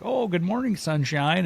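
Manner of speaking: high-pitched teasing greeting; mocking mouthing off. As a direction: imitate buddies needling a late sleeper, pitch way up. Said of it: "they're mouthing off".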